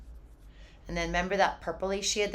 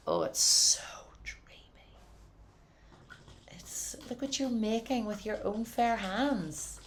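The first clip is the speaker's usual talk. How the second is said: awed whisper; 5 semitones higher than usual. Real, not acted. Teacher whispering praise to painting students. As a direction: breathe it to them like a secret.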